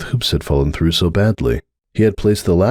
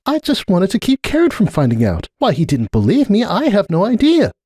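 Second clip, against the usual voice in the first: high exasperated mare's voice; Cupcake, exasperated; 13 semitones higher than usual. Acted. Cupcake venting to Starlight about Sunburst, high exasperated. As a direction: vent the frustration in a high voice.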